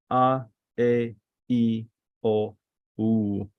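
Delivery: "A, E, I, O, U" evenly spaced, one by one; vowel recitation. Shown as a demonstration. Teacher repeating the vowels for students, separated. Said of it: "let me repeat, the vowels".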